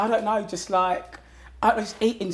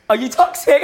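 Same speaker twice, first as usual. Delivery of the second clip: higher, louder, laughing as you ask; laughing tease; 8 semitones higher, 10 dB louder than usual. Real, not acted. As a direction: tease him through a giggle. Teasing date while laughing.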